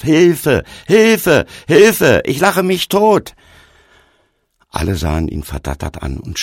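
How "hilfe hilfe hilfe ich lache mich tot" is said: high, loud mock cries for help; hysterical calling. A narrator's reading. Stranger holding his belly in amusement, crying out hysterically. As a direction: cry out as if helpless with mirth. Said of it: "Er hielt sich den Bauch und rief".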